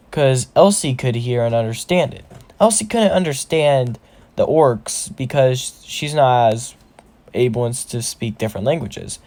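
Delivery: plain reading voice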